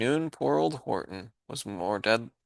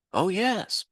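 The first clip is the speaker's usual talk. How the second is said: much higher piping reply; piped; 10 semitones higher than usual. Acted. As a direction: pipe it like a tiny mayor. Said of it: "piped the voice".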